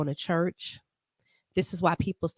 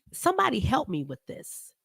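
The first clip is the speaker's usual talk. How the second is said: usual speaking voice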